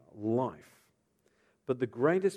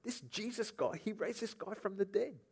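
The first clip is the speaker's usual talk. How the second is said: higher, softer gossiping tone; raised pitch, quiet alarmed telling; hushed report; 8 semitones higher, 7 dB quieter than usual. Acted. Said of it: "they said after they told them".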